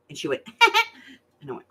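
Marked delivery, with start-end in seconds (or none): none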